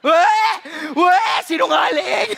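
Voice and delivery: high, loud jeering disbelief; mocking shout. Passengers jeering the crying preacher as a liar, loud mock shout. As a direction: jeer it out loud like a crowd calling a liar. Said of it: "Sabi ng mga tao".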